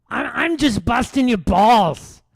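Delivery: loud, high-pitched whiny comedian parody; Bill Burr. Acted. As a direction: shout it as a mocking, high whine. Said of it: "I'm showing him what he sounds like".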